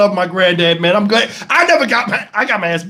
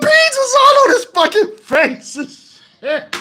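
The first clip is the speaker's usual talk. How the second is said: high pitched, slow, cracking up; barely getting it out through laughter; laughing; 13 semitones higher, slower than usual. Real, not acted. Cracking up telling it.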